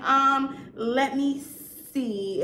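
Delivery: drawn-out, very slow mock hesitation; imitated stalling. Buyer stalls seller while checking phone, mock hesitant. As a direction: drag it out as if buying time.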